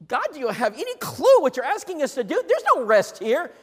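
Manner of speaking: high, loud protesting plea; mock protest to God; complaining Israelite. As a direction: whine at God like a weary soldier. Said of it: "there were some saying".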